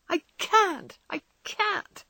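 high, breaking cry of grief; despairing cry. Acted. Jo refuses to give up Beth, sobbing. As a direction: let the voice crack into sobs. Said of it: "she cried despairingly".

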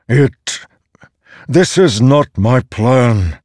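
slow, loud, high protest; forceful, halting start, then insist; heated denial. A narrator's reading. Storm god denying the plan is his, heated.

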